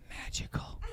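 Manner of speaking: hushed whisper of wonder; whispered "magical"; whispered awe. Pretend. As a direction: breathe it like you've seen a miracle. Awed aside after the trick.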